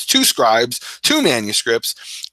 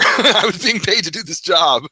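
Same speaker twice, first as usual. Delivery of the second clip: higher voice breaking into laughter; laughing admission; 7 semitones higher than usual. Real, not acted. Admitting he wasn't a good worker, laughing. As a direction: laugh through the confession. Laughing at his own confession.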